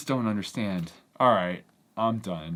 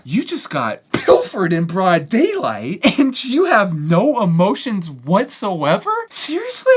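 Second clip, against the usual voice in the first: louder, higher exasperated rant; incredulous ranting, pitch climbing on seriously; exasperated disbelief; 10 semitones higher, 10 dB louder than usual. Retold or imitated. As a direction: scold him for not caring. Exasperated at the unbothered victim.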